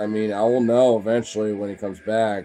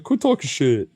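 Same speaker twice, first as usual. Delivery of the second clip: loud, higher mock retort; voicing the dog; 4 semitones higher, 7 dB louder than usual. Dog answers the owner's teasing, mock annoyed. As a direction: talk back as the offended dog. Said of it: "He's like".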